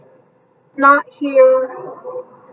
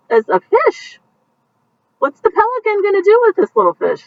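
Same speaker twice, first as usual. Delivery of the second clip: playful excitement; 10 semitones higher, faster than usual. Real, not acted. Playful picture book commentary.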